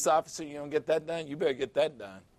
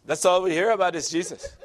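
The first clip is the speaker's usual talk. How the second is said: laughing reply; 3 semitones higher, 3 dB louder, faster than usual. Retold or imitated. Woman answers, laughing.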